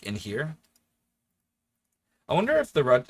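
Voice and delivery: normal talking voice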